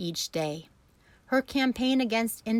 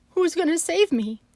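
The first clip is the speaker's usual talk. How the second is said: eager plea; 10 semitones higher, 6 dB louder than usual. A narrator's reading.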